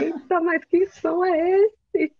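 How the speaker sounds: high-pitched, drawn-out mock amazement; exaggerated, slow, high-voiced wonder; astonished colleagues. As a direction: imitate coworkers marvelling at a fancy sound. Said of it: "o pessoal fica falando".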